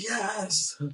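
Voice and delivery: high, gleeful shout of yes; mock-gleeful yes; excited cheer. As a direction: cheer him on like a delighted boss. [name] cheering the rat eater.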